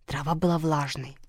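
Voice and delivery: ordinary delivery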